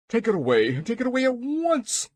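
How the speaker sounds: panicked shout. A narrator's reading. King orders the egg removed from his throne, shouting. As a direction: shout it, pitch climbing sharply. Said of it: "he shouted".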